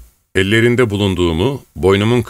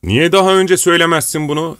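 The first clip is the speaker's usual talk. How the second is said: excited outburst; 9 semitones higher than usual. A narrator's reading. Pugachev delighted, excited outburst.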